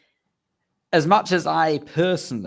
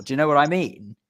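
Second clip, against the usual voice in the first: usual speaking voice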